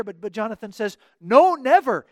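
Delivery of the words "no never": NO, NEVER punched loud and higher; emphatic denial, hard stress on No and never. Retold or imitated. Jonathan assures David he won't die, very emphatic. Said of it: "this is very emphatic in the Hebrew text".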